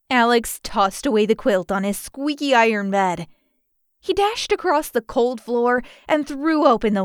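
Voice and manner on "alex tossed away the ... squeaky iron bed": usual speaking voice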